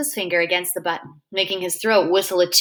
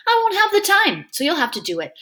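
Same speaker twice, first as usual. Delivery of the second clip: insisting; 9 semitones higher than usual. Acted. Freak insisting, high.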